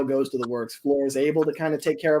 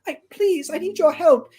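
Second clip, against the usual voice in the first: pleading little train; 14 semitones higher, 5 dB louder than usual. Acted. Flora begs for help.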